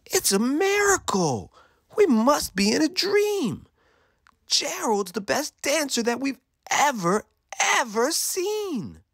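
high-pitched awestruck exclamations; amazed shouting. A narrator's reading. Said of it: "They shouted".

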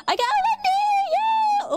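high excited shriek; excited squeal. Real, not acted. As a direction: squeal with joy at the pull. Excited gacha pull.

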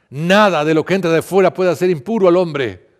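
loud, high proclamation; public proclamation. A narrator's reading. Jesus teaching the people he called, loud proclamation. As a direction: proclaim it to the whole crowd. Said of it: "llamó a la gente y dijo".

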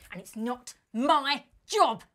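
slow, punched-out insults; exasperated, contemptuous telling-off; angry scolding. Acted. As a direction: spell it out slowly and furiously.

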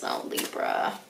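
breathy whisper of Libra's name. Real, not acted. Tarot reader whispering tenderly to Libra viewers.

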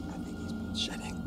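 quick, voiceless whisper. Retold or imitated. Friend doubting the ghost hunter to his girlfriend, whispered. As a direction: imitate a furtive aside under the breath. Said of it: "he goes to whisper to her".

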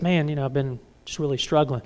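low-key, subdued confession; confiding a struggle. Acted. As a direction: admit it quietly to a friend. Acting someone confiding a struggle.

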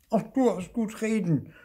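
high-pitched, peeved retort; scolding. A narrator's reading. Lost Lucy answers teasing Marti, scolding. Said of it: "schimpfte Lucy".

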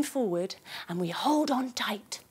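whisper. A narrator's reading. Kids yoga story, holding tight.

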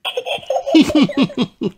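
high piglet giggle; quick squeaky tee-hee. A narrator's reading. Peppa is invited to surf, giggling. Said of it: "Peppa giggles".

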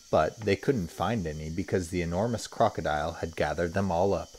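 usual speaking voice

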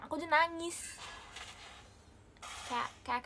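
ordinary talk

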